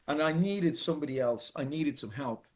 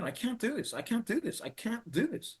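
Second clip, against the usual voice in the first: higher, softer, worn-down pleading; despairing repetition; 7 semitones higher, 4 dB quieter than usual. Retold or imitated. On the train back, overwhelmed by the new regime: high, soft despair. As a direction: keep repeating it like you've given up. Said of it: "I just kept saying".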